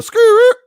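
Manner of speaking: loud, very high skrrt noise; imitated skrrt call. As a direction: let out a piercing imitation warning call. Imitates the warning sound given instead.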